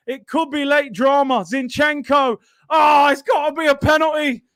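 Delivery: loud, high, urgent shouting; real frantic fan outcry at a handball appeal; frantic excited shouting. Real, not acted. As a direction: yell as the late drama unfolds. Late handball appeal, fan erupts.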